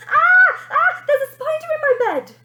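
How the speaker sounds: high, loud spider panic; scream. Acted. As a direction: shriek at the spider in bed. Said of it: "they heard Bonnie scream".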